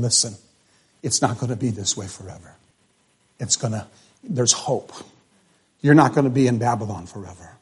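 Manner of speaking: gentle reassurance. Acted. Prophet quietly reassuring the captives in Babylon, hushed and tender. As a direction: speak it softly like comfort to exiles.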